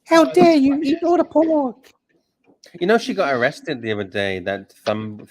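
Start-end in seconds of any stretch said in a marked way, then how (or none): 0.1-1.9 much higher and louder, scolding outrage; mock Greta Thunberg. Host parodies the activist over the eaten pork, hammed-up scolding. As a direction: shriek the accusation like an outraged activist. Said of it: "Greta Thunberg".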